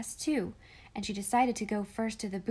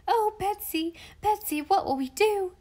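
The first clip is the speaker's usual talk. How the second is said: put-on much higher, louder panicked child; a terrified little girl gasping for help; 10 semitones higher, 5 dB louder than usual. A narrator's reading. Molly panicking to Betsy, high and frightened. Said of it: "she gasped".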